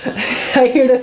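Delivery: ordinary talk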